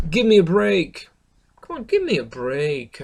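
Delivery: mock irritation, voice lifted; a little annoyed. Shown as a demonstration. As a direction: act mildly fed up. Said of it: "lorsqu'on est un petit peu énervé".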